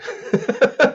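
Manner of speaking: a breathy, airy burst of laughter; unvoiced laugh. Real, not acted. Host laughs at the fight question, airy laugh.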